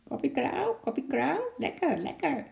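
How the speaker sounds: high, squawking parrot voice; schreeuwende papegaai. A narrator's reading. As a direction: squawk it like a cheeky parrot, pitch shooting up at the end. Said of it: "de paar woorden schreeuwde". (hoge, krijsende papegaaienstem)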